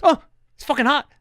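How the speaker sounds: retold cry of shock; mock startled yelp. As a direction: react as if touching a hot door. Super touches burning door, retold.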